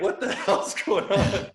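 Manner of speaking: slow, amused what-on-earth; laughing. Retold or imitated. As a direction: play the baffled onlookers through a laugh. Imagined onlookers, laughing.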